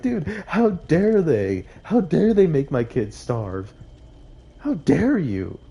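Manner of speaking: mock outrage. Reliving her anger at McDonald's, in mock outrage. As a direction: play up the indignation for laughs. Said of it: "I was like".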